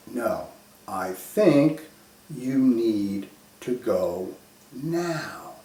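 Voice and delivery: drawn-out, soft insistence; talking real slow. Retold or imitated. Mother slowly insisting her son go to hospital now. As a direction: stretch each word out, pleading quietly. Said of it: "now she starts talking to me real slow".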